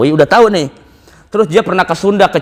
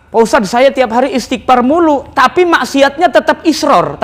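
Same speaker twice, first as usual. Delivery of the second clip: complaining congregant; 7 semitones higher than usual. Retold or imitated.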